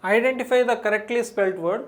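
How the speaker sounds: slow, deliberate question read. Shown as a demonstration. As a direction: read it out clearly for the class. Teacher reads question aloud slowly. (నెమ్మదిగా ప్రశ్న చదవడం)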